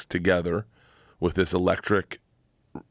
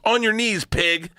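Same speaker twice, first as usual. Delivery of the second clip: raised-pitch imitation of a commanding dominatrix; mock dominatrix order; 12 semitones higher, slower than usual. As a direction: give a brisk mock command. Acting out dominatrix's first order.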